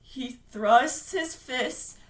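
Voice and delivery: slow, drawn-out words; laboured recitation. Acted. Bill reciting against the monster, slow and laboured. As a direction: force out each word slowly.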